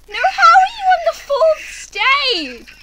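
high, loud, slow disbelief; shocked disbelief. Real, not acted. Shocked at friend's progress.

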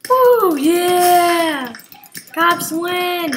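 high, loud victory whoop; excited cheer. Real, not acted. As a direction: cheer the win. Winning the round.

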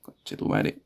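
breathy whispered swear; whispered curse. Real, not acted. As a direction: hiss the curse under breath. Scared before going outside.

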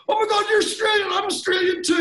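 high, loud, over-excited squeal of recognition; mock-ecstatic outburst; gushing greeting. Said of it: "'cause they go".

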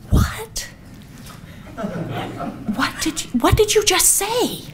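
breathless high whisper, half-voiced; shocked whisper. Mother in the silent church, whispering in shock. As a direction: hiss it in disbelief so nobody hears.

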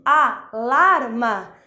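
"alarma" devagar, em sílabas, com força em LAR; tônica em LAR. Shown as a demonstration. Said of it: "mas sim o lar". ("alarma" escandido, acento na segunda sílaba)

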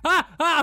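two short, loud yelps; startled yelps. Real, not acted. Player spooked by mutants, startled yelps. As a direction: jump with a sharp startled cry. Okay, I'm scared.

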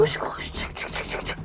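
hurried whispered escape orders; urgent whisper. Real, not acted. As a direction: whisper it, rushing out. Escaping the store. (kaçarken fısıltı)

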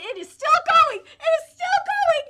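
slow, high, loud emphasis on each word; excited shouting. Real, not acted. Fan marvelling that the saga continues, excited shouting. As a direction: belt it out in disbelieving joy.

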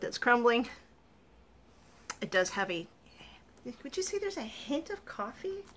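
chewing while talking; mouth full of cake. Real, not acted. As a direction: hand over mouth eating. Seen 0: woman holds cake at lips, biting and chewing while speaking.

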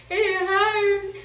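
high, drawn-out imitated wail; hammed-up crying in pain; mock crying. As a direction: whimper it high, re-enacting the tears. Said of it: "I was crying".

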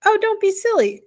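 high-pitched, amused scoff. A narrator's reading. Arrietty dismissing the boy's claim, amused. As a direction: brush it off as ridiculous, pitch jumping up. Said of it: "Arrietty laughed".

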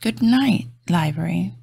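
usual speaking voice

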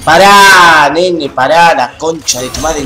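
loud, high and angry, telling him to stop; angry yell. Real, not acted. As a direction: shout it high and loud at the intruder. Yells at someone interrupting him.

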